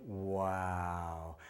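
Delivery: low, hushed, drawn-out wow of wonder; amazed wow. Shown as a demonstration. Showing a child how to react to the beep, hushed amazed wow. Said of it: "learn how to say".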